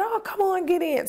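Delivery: high, pushy coaxing, imitating the driver; mock sweet-talk to lure her in; coaxing stranger. Driver urging her into his car, high coaxing voice. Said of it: "And he said".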